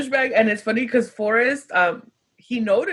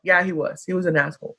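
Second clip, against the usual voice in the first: put-on boyfriend's low agreement; 6 semitones lower, faster than usual. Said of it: "He's like".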